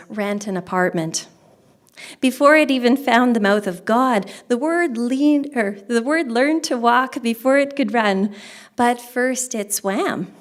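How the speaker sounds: projected recital with punched line openings; poetry reading. Acted. Poet reading Evolution of the Word to an audience, projected recital. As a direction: read it as verse, in separate phrases. Reads second poem aloud.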